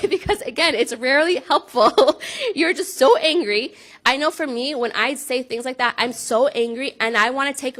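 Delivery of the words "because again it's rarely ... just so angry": slow, loud, laughing through it; a real laugh at herself. Real, not acted. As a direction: chuckle at her own failing. Laughing at her own yelling habit.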